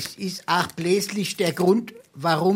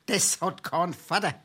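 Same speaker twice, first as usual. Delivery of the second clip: usual speaking voice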